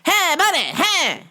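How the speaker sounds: high, loud nagging hey buddy; pushy customer. Retold or imitated. Customer badgering a busy worker, high and loud. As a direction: pester like an impatient customer. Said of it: "and he's like".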